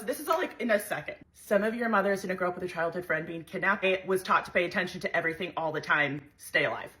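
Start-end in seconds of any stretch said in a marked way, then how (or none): none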